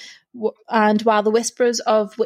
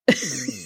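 wheezy laugh; 6 semitones lower than usual. Real, not acted. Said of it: "such an old lady smoker's laugh".

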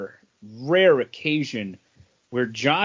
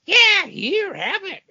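very high, squawky mock Gilbert Gottfried; slower than usual. Offering the book in a high Gilbert Gottfried impression. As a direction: pitch way up into a comedian's squawk. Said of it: "do the Gilbert Godfrey voice".

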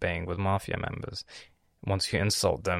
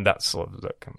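normal talking voice

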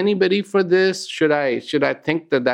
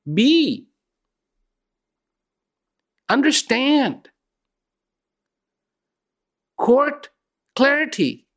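slow, high, spaced-out calls to be; inner call; 7 semitones higher than usual. Retold or imitated. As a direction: voice the heart's call with long pauses. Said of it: "that call is saying".

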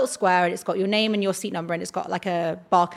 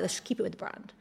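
whispered; 13 dB quieter, faster than usual. Retold or imitated. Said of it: "I was like".

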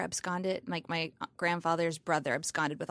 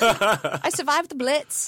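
mock old Brit; 5 semitones higher, 6 dB louder, much slower than usual.

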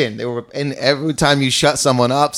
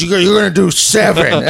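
raised, louder, hurried mock command; intimidating manager voice; 6 semitones higher, 5 dB louder, faster than usual. Giant club manager giving comics their time, mock intimidating. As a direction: imitate a huge man's intimidating order. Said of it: "his voice was even, like, intimidating".

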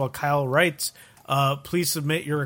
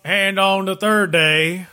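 slow, loud mock-preacher southern drawl; 3 semitones higher, 6 dB louder, slower than usual. Someone who sounds like they go to church, southern drawl. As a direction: draw it out like a southern churchgoer reading scripture. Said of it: "like, a southern drawl".

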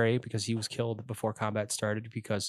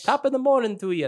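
high, hammed-up Irish greeting; Irish accent; 11 semitones higher than usual. Shown as a demonstration. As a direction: stock stage Irish accent. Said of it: "That's Irish".

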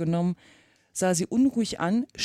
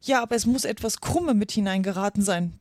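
ordinary delivery

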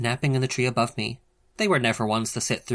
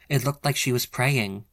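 ordinary delivery, nothing marked

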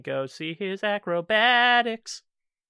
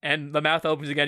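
ordinary talk, nothing marked

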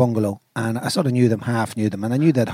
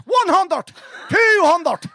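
high auctioneer-like Cavan call; Cavan accent; much slower than usual. Retold or imitated. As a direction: call out the figures in broad Cavan. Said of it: "in a Cavan accent".

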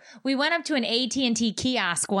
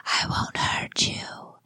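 slow, unvoiced whisper; creepy whisper; 4 dB quieter, slower than usual. Wife imitates the decoration's voice again, slow whisper. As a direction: breathe it slowly like a lurking stranger. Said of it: "it goes again".

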